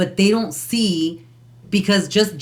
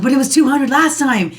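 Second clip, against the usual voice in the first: mock protest; 7 semitones higher, 5 dB louder than usual. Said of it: "She's like".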